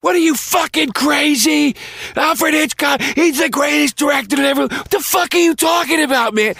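screaming in outrage. Retold or imitated. Joe screaming at Patrice for knocking Hitchcock, in a high outraged shout. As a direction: yell like he's insulted your hero. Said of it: "screaming at him".

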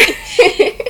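loud laugh. Real, not acted. Host laughs at her guest's basic facts, loud burst.

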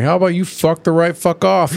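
lightly voiced New York tough guy; a hint of a pushy street accent; tough rock voice. Recapping the cartoon, voicing Rocky threatening Rainbow Dash. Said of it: "Rocky gets in her face, like".

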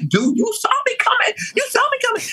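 high-pitched exasperated outburst at the driver; pissed off. Retold or imitated. Telling the truck driver who hit her car, high and exasperated. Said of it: "I was pissed the fuck off".

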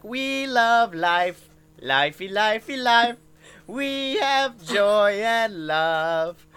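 put-on slow, high sing-song robot-kid voice; drawn-out cheery chant of a creepy doll; slow sing-song animatronic child; animatronic children.

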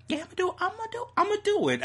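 high, hyped repeating; excited. Real, not acted. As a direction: psych yourself up. Excited self-hype.

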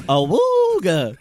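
loud, slow awooga; awooga horn. Acted. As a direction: honk it out like an old car horn. Car horn joke.

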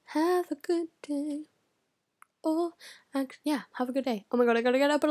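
ordinary talk, nothing marked

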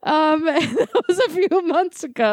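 high tearful laughter; laughing. Real, not acted. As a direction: laugh until it hurts. Laughing after the story.

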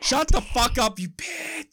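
sudden high, loud snap; hammed-up screaming retort; mock triggered outburst. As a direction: explode as if a button was pushed.

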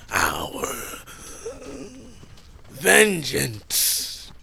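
rasping, defiant threat; a touch of vengeful hiss; defiant dying king. Acted. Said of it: "Di Xin says".

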